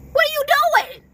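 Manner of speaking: high incredulous what are you doing. Real, not acted. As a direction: throw up your hands in disbelief. Scolding the listener.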